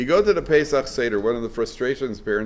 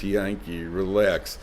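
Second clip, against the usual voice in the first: soft, low, unbothered; gentle self-calming; calm soothing; 5 semitones lower, 5 dB quieter, much slower than usual. Retold or imitated. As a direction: soothe yourself quietly. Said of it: "he says".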